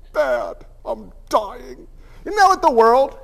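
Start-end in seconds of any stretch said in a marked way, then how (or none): 0.1-1.9 a hint of a moaning mom on the phone; mom's gloomy reply.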